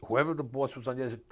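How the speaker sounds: normal talking voice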